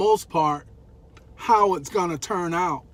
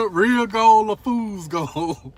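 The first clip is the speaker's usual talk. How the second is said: laughing tease; 4 semitones higher, 7 dB louder, slower than usual. Real, not acted. Joking gold digging warning, laughing.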